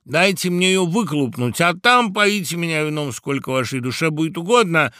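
animated bird begging a delay; woodpecker answering. A narrator's reading. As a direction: call out to hold off the crowd. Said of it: "отвечал дятел".

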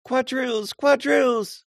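very high, loud, excited calls; excited crying out. A narrator's reading. Woman in pansy announcing the dance, very high and excited. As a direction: call the dance out in delight. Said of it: "excitedly clapping her hands and crying".

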